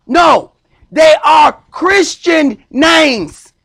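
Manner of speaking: full-force sermon declaration; preaching shout. Acted. Preacher repeats his point, shouting.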